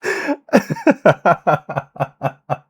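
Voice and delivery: high, loud, long real laughter; long laughing fit. Real, not acted. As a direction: let the laugh roll on.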